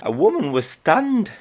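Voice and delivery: attempted Geordie twang on stunned; Geordie accent. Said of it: "I can't do a Geordie accent".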